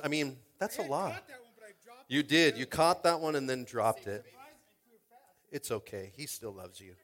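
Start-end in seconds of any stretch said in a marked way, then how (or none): 5.5-7.0 soft, low mock comfort; gentle whispered-down reassurance; tender consoling. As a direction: hush and reassure like soothing a child. Comforting kid who dropped baby.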